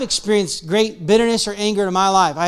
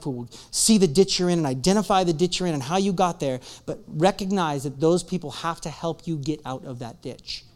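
ordinary talk, nothing marked

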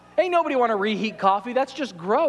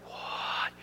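whispered what; 12 dB quieter than usual. Retold or imitated. Amazed reaction to the ad.

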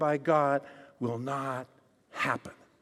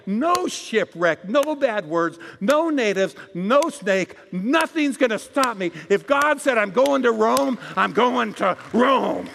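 louder and louder, declaring every "no" with force; preaching climax; 3 semitones higher, 5 dB louder than usual. Acted. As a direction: build the sermon to a triumphant shout. Sermon climax building.

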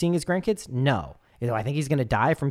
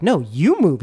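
louder flat refusal; retold refusal; 2 semitones higher, 8 dB louder, slower than usual. Noah refusing the stranger, louder. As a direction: push back hard.

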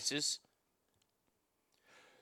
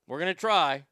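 usual speaking voice

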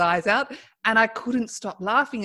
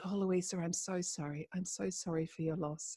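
much softer, a gentle sympathetic hush; hushed condolence; 13 dB quieter than usual. Retold or imitated. Relative consoling her after the service, hushed and gentle. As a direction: soothe a grieving granddaughter softly.

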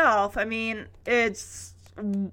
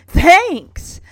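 high, loud, beaming burst; hammed-up delighted exclamation; smiling thanks; 7 semitones higher, 11 dB louder than usual. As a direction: thank them with over-the-top cheer. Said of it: "Alan smiles, saying".

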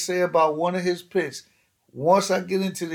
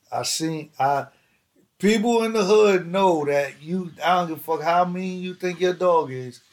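ordinary talk, nothing marked

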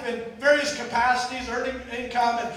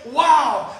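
loud amazed "Wow"; 8 dB louder than usual. Pretend. God praising big results, loud amazed wow. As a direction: exclaim in wonder.

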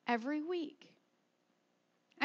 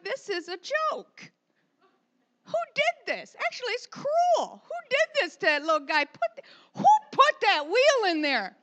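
mock outrage; 6 semitones higher, 7 dB louder than usual. Mock outrage at pet store.

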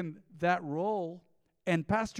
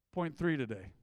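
normal talking voice

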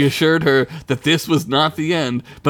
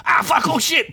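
high-pitched startled yelp; hammed-up panic; panicked cry; 13 semitones higher than usual. A narrator's reading. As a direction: yelp it out high, caught off guard. Vampire dazzled, falls from ceiling.

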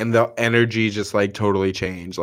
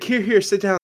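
mock flustered apology; 12 semitones higher than usual. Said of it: "she was like".

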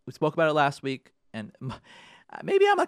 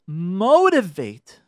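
Motivate drawn out slowly and louder; key word stressed; 2 semitones higher, 8 dB louder, much slower than usual. Shown as a demonstration. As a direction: lean hard and slow on Motivate. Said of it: "Here's the key word".

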